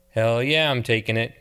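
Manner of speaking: ordinary delivery, nothing marked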